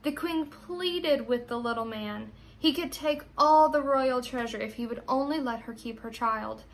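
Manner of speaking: ordinary delivery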